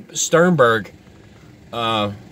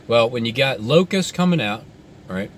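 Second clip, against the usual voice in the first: usual speaking voice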